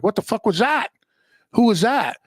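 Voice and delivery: high-pitched, bewildered outburst; baffled disbelief. Retold or imitated. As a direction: retell a stunned "what just happened?". Stunned reaction to baffling doctor leaving.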